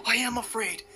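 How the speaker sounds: high frightened whisper; scared whisper. Real, not acted. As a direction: breathe it out, spooked. Reacting to spooky EVP answer.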